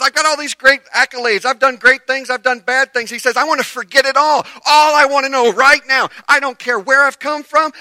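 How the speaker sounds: high, very loud impassioned preaching; full-force preaching. Acted. Preacher voicing Paul forgetting it all, fervent shout. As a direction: drive each line home at top volume.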